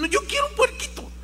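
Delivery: put-on high, fast whining child's plea; bratty child insisting, high and fast; whiny tantrum. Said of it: "Hizo su berrinche".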